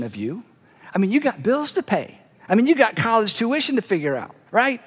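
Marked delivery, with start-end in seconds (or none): none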